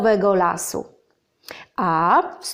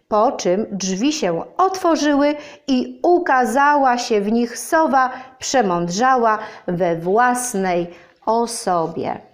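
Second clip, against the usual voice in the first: plain reading voice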